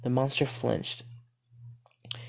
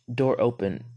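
plain reading voice

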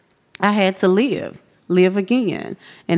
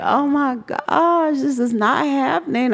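retold dismay; 8 semitones higher than usual. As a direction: stretch it out high, as if overwhelmed.

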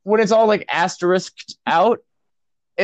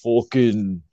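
ordinary delivery

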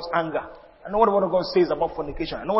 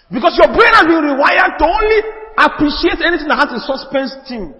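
much louder and higher, preaching at full force; preaching shout; 8 semitones higher, 8 dB louder than usual. Real, not acted. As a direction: drive the point home to the congregation. Preaching climax.

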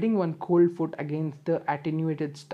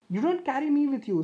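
put-on higher, girlish voice for Daisy; Daisy's voice; 9 semitones higher than usual. A narrator's reading. Daisy to the philosopher on the roof, higher uneasy voice. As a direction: lift into the young shopgirl's voice, uneasy.

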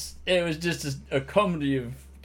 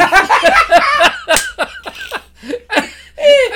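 long high belly laugh; high loud cackle; 13 dB louder than usual. Real, not acted. Telling mate the story, big high laugh. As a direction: burst out laughing at the punchline.

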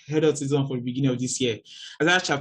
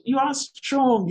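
reassuring friends; 10 semitones higher, slower than usual. Retold or imitated. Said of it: "they are the ones currently who are telling me".

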